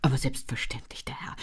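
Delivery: hushed, fawning whisper; obsequious. A narrator's reading. Servant fawning over a paying guest, hushed and obsequious. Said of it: "Miene des Knechts auf einmal freundlich".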